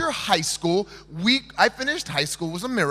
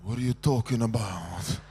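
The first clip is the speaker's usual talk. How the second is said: much lower, softer, scornful mumble; dismissive brush-off; 12 semitones lower, 7 dB quieter than usual. Retold or imitated. Girl brushing off an unwanted boy, low and scornful. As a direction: dismiss him in a low grumble. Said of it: "you can look back and be like".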